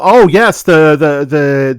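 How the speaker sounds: loud sudden recall; excited. Real, not acted. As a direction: burst out remembering. Sudden recollection.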